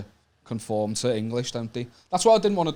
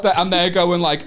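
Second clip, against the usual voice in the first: normal talking voice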